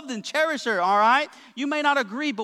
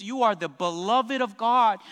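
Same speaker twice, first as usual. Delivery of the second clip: normal talking voice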